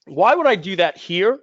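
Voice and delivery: ordinary talk, nothing marked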